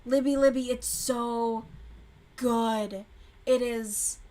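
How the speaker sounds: loud, drawn-out gushing. Real, not acted. Urging viewer Libby to keep watching the show, loud gushing. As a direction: plead with her to keep watching.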